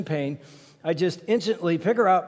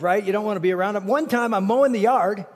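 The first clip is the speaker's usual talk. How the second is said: usual speaking voice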